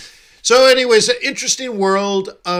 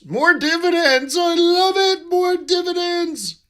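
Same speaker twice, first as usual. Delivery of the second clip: much higher, gleeful game character cheer; mock cheering; 14 semitones higher than usual. Streamer cheers the dividends his game village collects, high and gleeful. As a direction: squeal it like a greedy little merchant.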